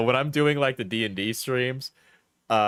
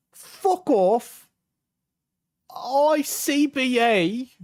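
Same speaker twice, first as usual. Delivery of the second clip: loud, high, incredulous mock British accent; put-on accent; 9 semitones higher, 3 dB louder, much slower than usual. Got this after saying "I'm sad", high mock British accent. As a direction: echo it back in outraged, hammed-up British. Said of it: "when I'm doing the accent".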